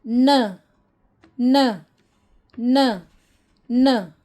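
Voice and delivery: "न" as a short na sound, not "en"; letter sound n. Shown as a demonstration. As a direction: sound out "न" crisply, repeated. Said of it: "इसकी आवाज़ निकलती है न".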